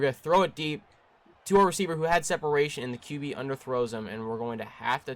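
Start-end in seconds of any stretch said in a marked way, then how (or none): 0.0-2.8 higher and louder, rushing the end; excited call. Real, not acted. Calling a deep throw.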